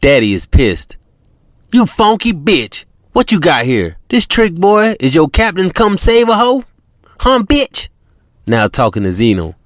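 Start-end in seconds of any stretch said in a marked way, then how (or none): 1.7-7.9 angry pimp. Acted. Pimp Daddy furious at China.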